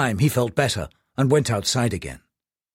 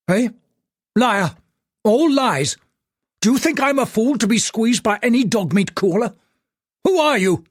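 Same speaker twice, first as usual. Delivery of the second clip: furious tycoon; 8 semitones higher than usual. Acted. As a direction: bark him down as a liar. Angry tycoon on the phone.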